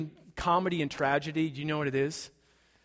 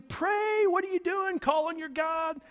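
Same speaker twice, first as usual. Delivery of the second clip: much higher urgent shout; captain's shout; 11 semitones higher than usual. Retold or imitated. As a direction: jolt the sleeping prophet awake. Captain wakes Jonah shouting.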